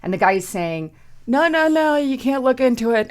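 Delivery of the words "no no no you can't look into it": mocking official. Mocking official blocking access.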